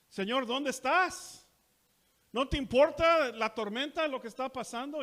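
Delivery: high, loud pleading cry; desperate cry. Acted. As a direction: plead over the storm. Acted cry of the disciples.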